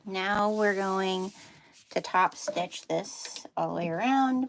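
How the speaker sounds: ordinary delivery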